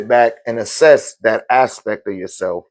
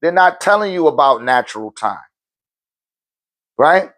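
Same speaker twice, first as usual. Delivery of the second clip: normal talking voice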